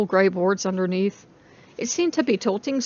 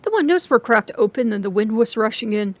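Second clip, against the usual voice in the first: plain reading voice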